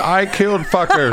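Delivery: laughing. Retold or imitated. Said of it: "a note that was previously written".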